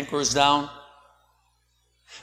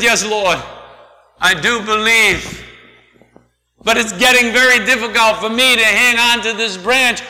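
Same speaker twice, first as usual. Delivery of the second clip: pleading; 5 semitones higher, 5 dB louder than usual. A narrator's reading. Man pleading while hanging on.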